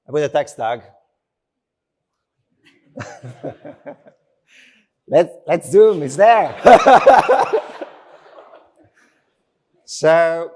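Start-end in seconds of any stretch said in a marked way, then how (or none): 3.0-3.9 soft laugh. Real, not acted. Live demo glitch, laughs. 6.6-7.9 loud high laugh; high burst of laughter. Real, not acted. Demo finally works, laughs.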